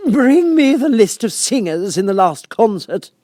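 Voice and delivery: high, loud, slow royal command; imperious Queen. A narrator's reading. Queen commands an officer, high and loud. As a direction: bark the order down the court.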